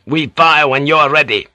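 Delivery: high, angry firing order; snarled order. Acted. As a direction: snap it out as an angry captain. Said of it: "the Captain General snarled".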